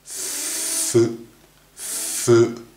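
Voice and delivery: a breathy F sound held briefly; English F demo. Shown as a demonstration. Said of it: "下の唇を噛むみたいな感じ". (下唇を噛んで「ふ」と息だけ)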